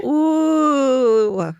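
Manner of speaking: high, loud ooh; impressed ooh. Real, not acted. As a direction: react with delighted surprise. Reacting to story.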